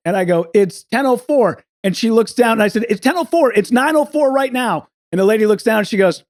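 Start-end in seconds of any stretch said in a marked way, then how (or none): 2.9-4.9 higher, insistent retold repeat; insistent protest. Traveler repeating the time to the airline lady, insistent protest. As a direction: press the point with rising exasperation.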